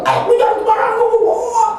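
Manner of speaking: high, fast mock-angry rant; retold angry outburst. Preacher re-enacting a phone fight with a sibling, mock furious. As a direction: act out losing your temper on the phone. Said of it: "saya mulai marah-marah juga".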